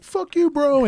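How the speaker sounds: high mock retort; heckler retort. Heckler firing back at the comic, high mock sneer.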